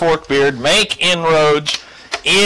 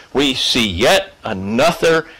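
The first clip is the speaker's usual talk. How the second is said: ordinary talk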